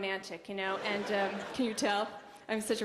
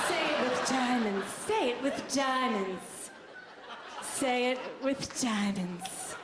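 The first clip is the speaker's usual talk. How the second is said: slowing, laughing slogan; mock jingle read; slogan through laughter; much slower than usual. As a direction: drag the slogan out while laughing.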